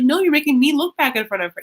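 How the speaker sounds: mock protest. Younger sister protests being embarrassed, mock hurt. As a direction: push back like a hurt kid sister.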